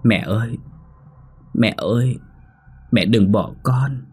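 put-on slow, mournful child's plea from afar; a ghost child whimpering for its mother, drawn out; lightly voiced weeping child calling mother; plaintive sobbing child. A narrator's reading. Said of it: "tiếng khóc thút thít nghe ai oán".

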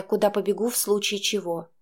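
usual speaking voice

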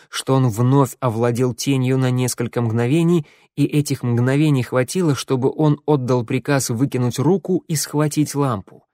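ordinary delivery, nothing marked

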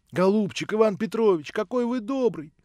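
high-pitched, delighted thanks; grateful gushing. A narrator's reading. Alyosha thanking the narrator for his help, gushing. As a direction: gush with relief and gratitude.

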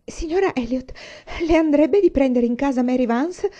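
high, loud, begging plea; pleading voice. Acted. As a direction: beg her earnestly, voice lifted. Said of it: "disse con voce implorante". (voce implorante)

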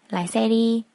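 ordinary delivery, nothing marked

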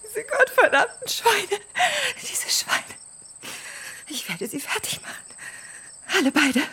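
broken, breath-caught cursing; tearful rage. Acted. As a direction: curse them through tears, voice giving out. Alone, upset after the quarrel. (weinend vor Wut)

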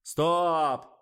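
loud, high drawn-out yell. A narrator's reading. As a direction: halt the take with a shout. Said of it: "завопил Николай".

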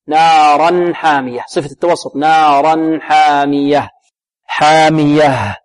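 نارا حامية with the nun of naran held for medium length, slow. Shown as a demonstration. Teacher modelling the medium-length nun. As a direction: stretch the nun of نارا a little. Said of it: "نعطي النون من التوسط".

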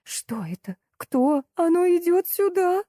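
acted panic, climbing high; undisguised horror. A narrator's reading. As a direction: start hushed, then rise to a high terrified cry. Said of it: "В голосе незнакомки звучал неприкрытый ужас". (ужас в голосе)

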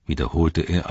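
ordinary delivery

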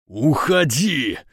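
enraged roar, first word drawn out. A narrator's reading. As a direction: bellow it in a voice not your own. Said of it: "взревел не своим голосом".

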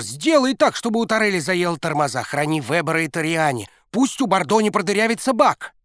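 high fervent hurried prayer; praying coach. A narrator's reading. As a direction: bargain with the saints. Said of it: "молился тренер".

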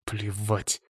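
soft, tight dismissal; contained anger, kept quiet; said through gritted teeth. A narrator's reading. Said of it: "процедил Самсонов".

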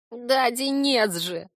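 slow, high, creaky creature voice; creaking, resigned admission; creaky sigh. A narrator's reading. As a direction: sigh it out reluctantly, drawn out. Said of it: "скрипуче вздохнул Корняга".

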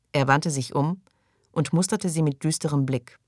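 ordinary delivery, nothing marked

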